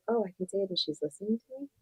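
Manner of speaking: soft, quick wondering aloud; hushed inner voice testing the idea; quietly, quickly, half asking; tentative realization. Acted. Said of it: "his brain goes like".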